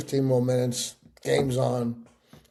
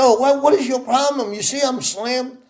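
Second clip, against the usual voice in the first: higher, louder irritated retort; annoyed snap; 9 semitones higher, 3 dB louder than usual. Retold or imitated. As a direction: brush him off, irritated. Ronald snaps at narrator.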